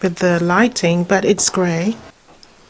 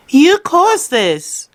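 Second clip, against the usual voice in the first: mock blame; 8 semitones higher, much slower than usual. Real, not acted. Blaming the dog, playful.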